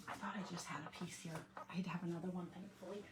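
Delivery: soft breathy whisper; whispered aside. Real, not acted. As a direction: hush so as not to disturb the guests. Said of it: "I'm whispering".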